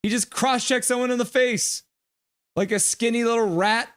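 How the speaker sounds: outraged rant. Real, not acted. Outraged at cross-check.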